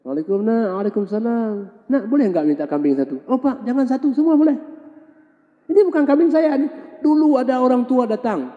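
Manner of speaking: high, eager and open-handed; cheerful generous man. Acted. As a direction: give it all away happily. Former blind man welcomes the old man. (mantan orang buta menyambut bapak tua dengan riang, suara tinggi)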